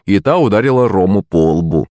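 ordinary delivery

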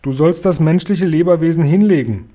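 commanding. A narrator's reading. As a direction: give a forceful command. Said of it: "befahl der Guri".

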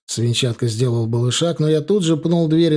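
usual speaking voice

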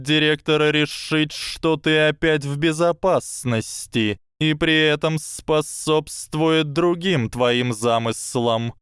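high, slow serpent voice; a hissing snake, slow and sly. Acted.

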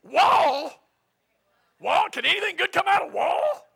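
loud, high, scoffing incredulity; mock disbelief. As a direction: cry out as if the town were unthinkable. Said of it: "it'd be like today saying".

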